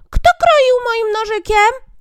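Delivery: very high, slow, loud squeak; dwarf voice. Acted. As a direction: squeal it slowly like the tiniest dwarf. Sixth dwarf asks. (bardzo wysoki, powolny pisk krasnoludka)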